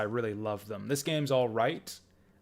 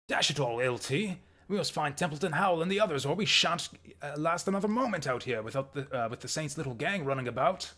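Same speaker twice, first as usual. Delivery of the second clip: higher-pitched mock British accent; 4 semitones higher than usual. British professor urging the lieutenant to find the others, British accent. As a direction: put on your best amateur British accent. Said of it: "that's my best British accent".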